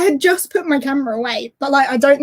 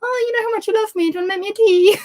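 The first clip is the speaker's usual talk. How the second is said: higher, fast buttering-up voice; imitating her fiancé's wheedling; wheedling boyfriend; 7 semitones higher, faster than usual. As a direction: sweet-talk for a favour. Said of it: "he's always asking me for little things like".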